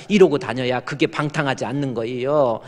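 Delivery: ordinary talk, nothing marked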